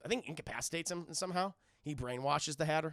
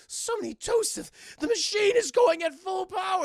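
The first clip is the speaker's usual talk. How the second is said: much higher, loud frantic yell; panicked shout; 13 semitones higher, 9 dB louder than usual. Retold or imitated. Mad Hatter panicking over the machine, shouting.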